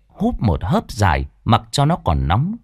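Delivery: ordinary delivery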